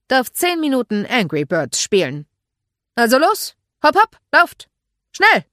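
loud order building to high, urgent shouts; commanding. Acted. Mark sending boys round the lake, urgent command.

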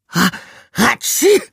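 big high sneeze. A narrator's reading. Mouse hit by sunlight on his nose, sneezing. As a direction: let the sneeze explode. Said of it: "machte er".

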